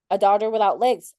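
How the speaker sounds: ordinary talk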